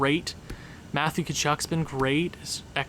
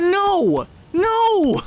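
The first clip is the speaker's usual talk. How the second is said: high, loud cry of no; anguished shout; 13 semitones higher, 5 dB louder than usual. Real, not acted. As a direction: yell it at the screen in despair. Reacting to a goal against.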